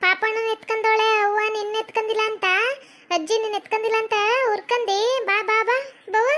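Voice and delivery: high, slow, drawn-out granny voice; grandmother coaxing child. Acted. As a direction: coax the little one in a high old-woman voice. (ಮಗುವನ್ನು ಮುದ್ದಿಸುವ ಅಜ್ಜಿಯ ಎಳೆದ ಧ್ವನಿ)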